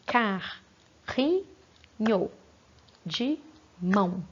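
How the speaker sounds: Carrinho de mão said slowly as car-ri-nho de mão; slow syllable count for kids; split into syllables. Shown as a demonstration. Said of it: "Se bater palma".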